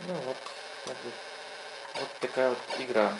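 normal talking voice

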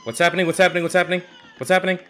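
loud, high, rapid-fire repeats; alarmed confusion. Real, not acted. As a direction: fire it out in mounting panic. Sudden confusion in game.

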